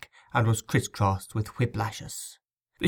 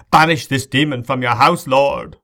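loud, raised plea to heaven; crying out; 4 semitones higher, 8 dB louder than usual. A narrator's reading. Cleric begs God to banish a demon, crying out. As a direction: call out an alarmed exorcism. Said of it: "he cried".

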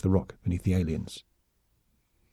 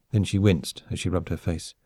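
ordinary delivery

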